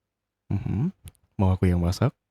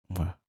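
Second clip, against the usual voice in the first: soft kiss sound; 12 dB quieter than usual. Acted. Kiss sound after goodnight.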